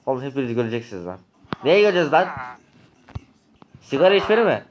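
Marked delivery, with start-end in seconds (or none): 1.6-4.6 angry taunt. Acted. Mocking taunt in quarrel.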